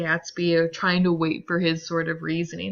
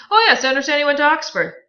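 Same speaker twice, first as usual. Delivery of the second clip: higher, louder, fast mock disbelief; mocking Tom; 9 semitones higher, 9 dB louder, much faster than usual. Tom mocks Gatsby's Oxford claim, fast high sneer.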